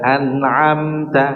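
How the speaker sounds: "An'amta" slowed, nun held with a little flow. Shown as a demonstration. Teacher isolating the word for students. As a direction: stretch "An'amta" gently on the nun. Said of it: "diberikan sedikit aliran".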